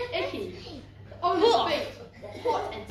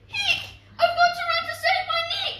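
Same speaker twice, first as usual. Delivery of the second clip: high, loud, hurried shout; urgent high-pitched shout, rushing it out; shouted cry; 13 semitones higher, 7 dB louder than usual. A narrator's reading. Cinderella at midnight fleeing the prince, urgent shout. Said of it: "She shouted".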